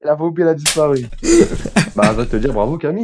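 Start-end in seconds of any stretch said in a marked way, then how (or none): 1.1-1.9 cracking up again; high laugh. Real, not acted. Laughing at one-session cure.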